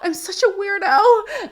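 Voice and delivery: high, slow, hammed-up tearful whine; mock her crying over being a weirdo, half laughing; laughing while parodying the cast member's emotional outburst; mock weepy Madeline. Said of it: "she's like".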